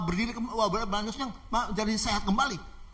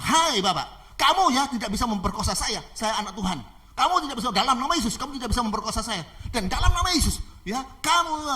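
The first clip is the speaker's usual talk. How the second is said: loud, raised commanding declaration; defiant rebuke; 5 semitones higher, 5 dB louder than usual. Acted. Girl rebuking her attacker in Jesus' name, defiant. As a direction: insist with rising force.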